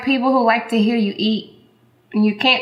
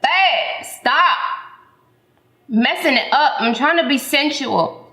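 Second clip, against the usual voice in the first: higher, pleading exasperation; exasperated; 4 semitones higher than usual. Real, not acted. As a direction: stop messing it up, babe. Scolding husband for interrupting.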